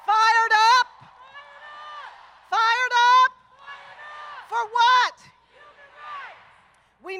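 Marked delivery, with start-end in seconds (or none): 0.1-5.2 loud call to the crowd; rally call. Real, not acted. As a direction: rouse the crowd again.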